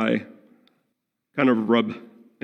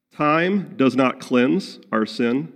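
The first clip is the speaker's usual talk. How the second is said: usual speaking voice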